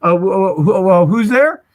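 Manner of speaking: stammering Mel, pitch climbing on who's there; imitating a stutter. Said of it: "he stuttered a little bit".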